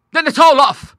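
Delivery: a loud, high outcry; high, loud, abrupt exclamation; crying out. A narrator's reading. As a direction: break off the deal with a sharp loud cry. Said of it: "she cried".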